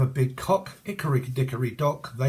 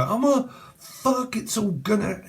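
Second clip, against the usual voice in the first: pitched-up pretend panic; mock panic; 9 semitones higher than usual. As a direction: imitate a viewer working themselves up. Imitating a panicking tarot viewer.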